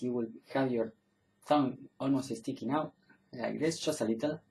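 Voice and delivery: normal talking voice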